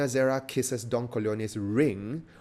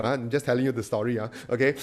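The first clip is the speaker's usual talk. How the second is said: ordinary talk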